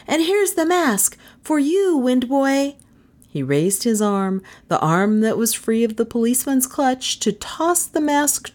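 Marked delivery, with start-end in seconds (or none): 0.1-2.8 put-on high, eager child presenting a prize; excited boy's cry. A narrator's reading. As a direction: hand it over proudly, voice high. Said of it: "he cried to them".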